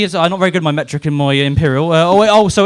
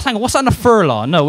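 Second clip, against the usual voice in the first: normal talking voice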